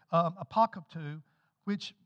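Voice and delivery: usual speaking voice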